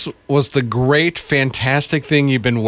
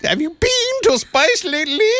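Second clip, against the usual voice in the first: much higher Australian attempt slipping into French; botched Australian accent. Shown as a demonstration. Co-host trying Australian and going French, high botched accent. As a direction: overdo the accent until it turns French. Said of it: "You went French at the".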